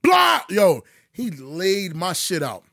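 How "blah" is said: a loud, high blah for the hit; punch sound effect. Retold or imitated. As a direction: smack the sound out like a punch landing. Imitating the punch landing.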